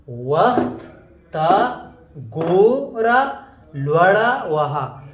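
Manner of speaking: each word hit loud and slow; emphatic slow recitation. Shown as a demonstration. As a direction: spell it out word by word for the class. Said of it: "teacher repeats it word by word".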